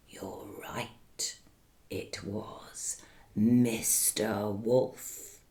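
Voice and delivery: whisper. A narrator's reading. Revealing the wolf.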